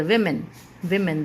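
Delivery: "women" said "VIM-in"; short i at the start of "women"; "vi" sound in women. Shown as a demonstration. Teacher modelling for students, "VIM-in". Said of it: "वी से होती है".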